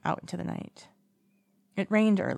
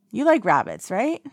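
louder, sharp rise on right; drunkenly eager question; playful tipsy ask; 5 semitones higher, 7 dB louder than usual. A narrator's reading. Drunk Wei Ying asking.

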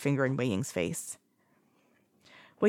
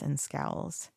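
ordinary delivery

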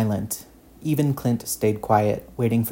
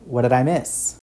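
tired smile; 2 semitones higher than usual. A narrator's reading. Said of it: "with a small, exhausted smile".

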